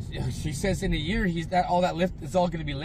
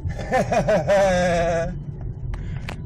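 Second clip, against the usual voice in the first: loud real burst of laughter; big laugh; 6 semitones higher, 8 dB louder than usual. Real, not acted. Vlogger laughs at people fleeing his visit, loud real laughter.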